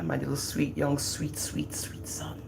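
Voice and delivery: soft slow whisper; whispered tenderly. Real, not acted. Mother whispering fondly about her youngest son, slow and hushed. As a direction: breathe the words like a secret.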